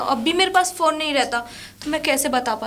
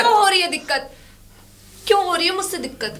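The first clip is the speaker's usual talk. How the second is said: high, heated repeated question; upset, high-pitched challenge; angry demand; 4 semitones higher, 3 dB louder, faster than usual. Acted. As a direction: fire the question back, raised voice. Woman demands why, quarrel peaks.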